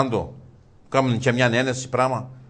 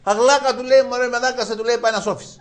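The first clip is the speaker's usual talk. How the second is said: high, loud call to his friend; calling for help; 12 semitones higher, 7 dB louder than usual. Acted. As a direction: shout for the friend in a panic. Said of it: "Φωνάζει του φίλου του". (φωνή για βοήθεια)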